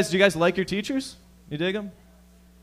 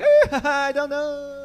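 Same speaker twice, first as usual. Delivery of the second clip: much higher, giggling mock Chinese accent; laughing Chinese accent; 11 semitones higher, 4 dB louder than usual. Driver driving away from the cop, giggling mock Chinese accent. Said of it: "then they turned Chinese".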